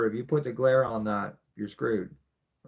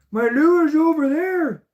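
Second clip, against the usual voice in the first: exasperated quote to the fish; 7 dB louder than usual. Retold or imitated. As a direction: squeal at the staring fish in a high put-on voice. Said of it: "And I'm like".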